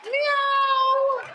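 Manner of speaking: very high, held meow; a long, dainty cat's meow. Acted. Comedian plays harmless Persian cat, high drawn-out meow. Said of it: "I am the cat".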